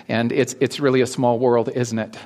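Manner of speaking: ordinary delivery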